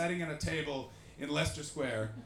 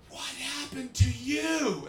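mock gawker; 9 semitones higher, 6 dB louder, much slower than usual. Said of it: "their entire lives have been".

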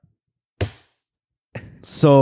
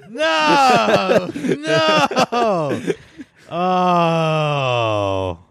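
long high drawn-out groan of dismay; mock wail; 9 semitones higher, much slower than usual. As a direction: wail it out like you've been had. Mock wail at a bad joke.

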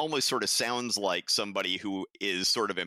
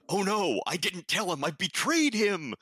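high, overwrought cry of guilt; mock panic; 9 semitones higher than usual. Co-host mocking a secretary's guilt over a letter, mock panic.

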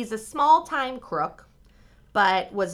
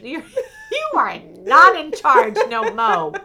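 loud, high, slow laughing scold; laughing mock scold; 8 semitones higher, 11 dB louder, slower than usual. Scolding absent Charles in jest, laughing loudly. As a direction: tease him through laughter.

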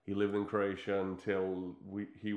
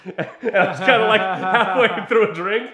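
loud, high, laughing through the words; 10 semitones higher, 13 dB louder than usual. Real, not acted. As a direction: tell it cracking up. Laughing while retelling embarrassing moment.

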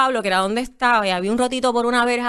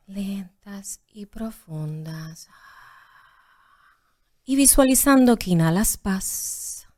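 calm breathing instructor, hushed and unhurried; yoga teacher voice; 4 dB quieter than usual. Shown as a demonstration. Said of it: "en tu voz de maestra de yoga".

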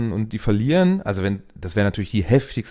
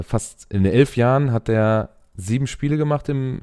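ordinary talk

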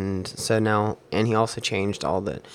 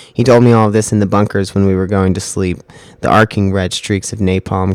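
louder dramatic storytelling read; spoken-word story; 13 dB louder than usual. Acted. Reading his sci-fi war story aloud over music, dramatic narration. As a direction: tell the war tale to the beat, louder.

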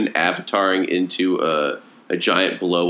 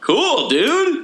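mock excited. Real, not acted.

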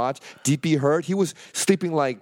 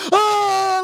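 high loud shriek; mock scream; 6 dB louder than usual. Friend seized by old man, high shrieking scream. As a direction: let out a startled yelp. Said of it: "Sean's like".

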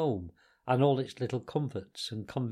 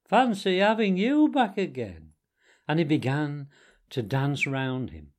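higher, louder happy voice; beaming delight; 6 semitones higher, 5 dB louder than usual. A narrator's reading. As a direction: gush with delight at the return. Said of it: "cried the mole, his face beaming".

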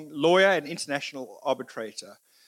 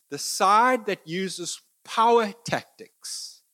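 The side that uses power tactics drawn out slowly, higher and louder, power hit hardest; slow emphatic stress; 6 semitones higher, 5 dB louder, much slower than usual. Real, not acted. Making the key point to the audience, slow raised emphasis.

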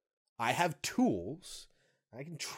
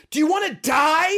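full scream, much higher and louder; boy screaming at his mother during a struggle; 15 dB louder than usual. Retold or imitated. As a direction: scream it at her like a terrified kid. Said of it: "he just screams out at her".

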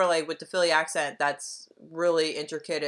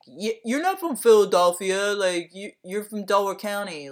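higher, louder mock of a Philly gatekeeper; gatekeeping snob; 4 semitones higher, 5 dB louder than usual. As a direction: scoff at the Delco person like a gatekeeper. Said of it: "people are like".

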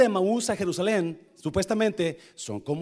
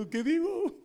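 high, timid little "what do I say?"; lightly voiced shy introvert; 11 semitones higher than usual. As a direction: play the nervous introvert. (imitación ligera de un tímido)

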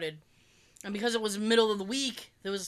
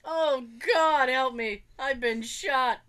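mock plea; 5 semitones higher, 3 dB louder, slower than usual. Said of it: "I should have yelled that".

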